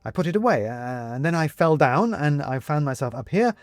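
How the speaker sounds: lightly voiced Benedict, uneasy; Benedict, anxious recollection. A narrator's reading. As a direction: rush through it as it slips away.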